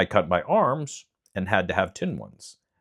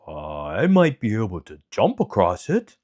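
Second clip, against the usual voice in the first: lightly voiced lion, offering help; a big cat proposing a bold leap; 4 dB louder than usual. Lion character voice.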